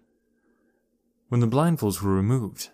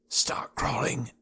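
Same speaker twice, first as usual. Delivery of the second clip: hissed whisper; 2 semitones higher, 7 dB quieter, much slower than usual. A narrator's reading. Beach hisses at the children to crawl in whispered hiss. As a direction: hiss the order under the breath. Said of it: "hissed Beach".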